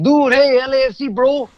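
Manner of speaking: friendly street bro, pumped at halftime; imitating LA fan bros. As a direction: play the hyped-up soccer fan greeting a stranger. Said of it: "They're like".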